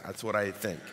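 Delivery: ordinary talk, nothing marked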